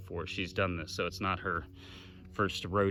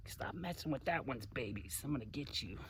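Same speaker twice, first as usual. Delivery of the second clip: protective mother alligator; 5 semitones higher, 10 dB quieter than usual. Retold or imitated. Mother alligator voiced as guarding.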